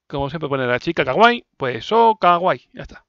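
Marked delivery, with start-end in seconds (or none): none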